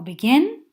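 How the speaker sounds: "begin" with the second syllable punched, be-GIN; final-syllable stress. Shown as a demonstration. Teacher comparing it with listen, stress on last syllable. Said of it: "acentuada la última sílaba".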